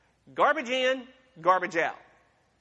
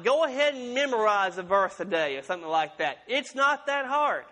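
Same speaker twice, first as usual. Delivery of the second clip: ordinary talk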